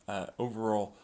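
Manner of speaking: ordinary talk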